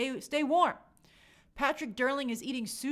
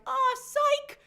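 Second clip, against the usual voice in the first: mocking taunt; 12 semitones higher, 6 dB louder than usual. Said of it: "and then be like".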